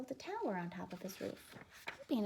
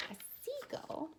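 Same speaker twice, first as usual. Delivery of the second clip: high seagull; 13 semitones higher than usual. A narrator's reading. Storyteller lifts seagull high.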